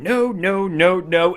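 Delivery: imitated stubborn string of refusals; insistent refusal. Margaret refusing the queen's demand, mock insistent. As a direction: lift it slightly, hitting each repeat.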